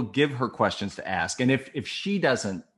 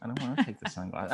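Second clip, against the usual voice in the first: lightly voiced whiny balking; imitating a reluctant woman; 2 semitones higher, 5 dB quieter than usual. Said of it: "she'd be like".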